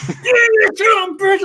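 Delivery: high, loud whooping yell; a sick coach cheering at the game on his hospital bed, shouting; excited shout. Retold or imitated. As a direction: yell it out jumping on the bed like a delighted fan. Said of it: "He starts jumping on the bed, going".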